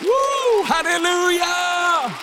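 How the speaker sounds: full-throated preacher building to the climax; preaching at full force. Acted. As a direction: preach it like the church is on its feet.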